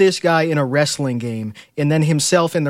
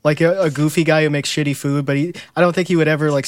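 ordinary delivery

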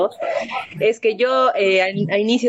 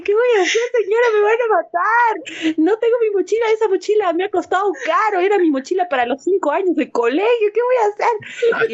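panicked plea; 12 semitones higher than usual. Retold or imitated. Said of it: "Y le dije".

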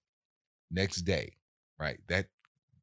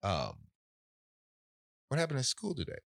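higher, softer, deceptively casual question; casual older brother; 5 semitones higher, 5 dB quieter than usual. Retold or imitated. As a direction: ask it lightly, like nothing's up. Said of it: "Just like that".

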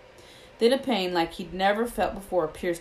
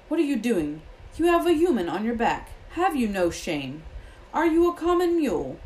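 raised, indignant scolding; thundering outrage; 6 semitones higher, 4 dB louder than usual. A narrator's reading. Bane rebuking Firenze for carrying a human, thundering. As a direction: thunder scandalised questions at a traitor. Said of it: "Bane thundered".

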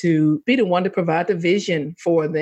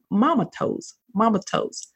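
put-on toddler voice; 4 semitones higher than usual. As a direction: coo it like a wobbly toddler. Toddler son voiced in story.

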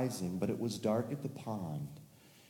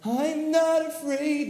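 much higher, louder, slow shout; brave shout; 7 dB louder, much slower than usual. Acted. Tommy flying over the pond, high brave shout. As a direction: shout your courage, slowly.

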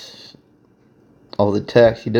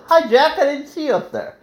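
very high-pitched, cutesy hello; sing-song greeting. Real, not acted. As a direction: break off into a high little greeting voice. Breaks off to greet Jack.

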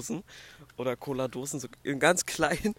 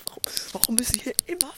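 annoyed whisper; 8 semitones higher, 5 dB louder than usual. Real, not acted. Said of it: "Das regt mich so".

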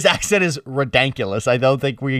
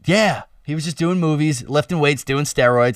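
ordinary talk

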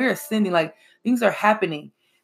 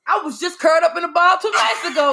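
higher and louder, exclaiming; almost shouting a confession; loud exclamation; 7 semitones higher, 4 dB louder than usual. Real, not acted. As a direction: blurt it out big and high. Exclaiming about recent pain.

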